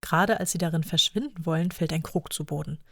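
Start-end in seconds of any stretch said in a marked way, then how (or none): none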